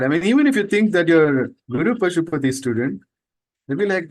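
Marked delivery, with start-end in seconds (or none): none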